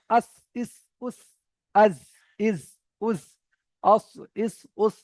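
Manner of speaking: আস ইস উস with the tongue tip on the teeth, a sharp clear hiss; Arabic letter drill, each syllable clipped; letter sound drill. Shown as a demonstration. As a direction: say আস, ইস, উস short and crisp. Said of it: "এমনভাবে উচ্চারণ হবে".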